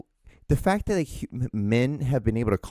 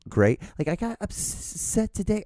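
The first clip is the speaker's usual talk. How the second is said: normal talking voice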